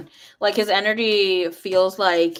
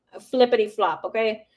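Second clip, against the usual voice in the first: ordinary talk, nothing marked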